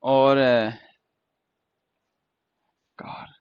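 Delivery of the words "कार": whispered word. Shown as a demonstration. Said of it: "एक word और लेते हैं".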